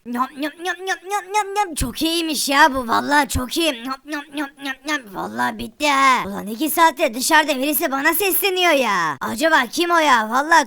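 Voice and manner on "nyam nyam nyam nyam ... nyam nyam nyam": munching noises. Acted. Eating food in someone's house.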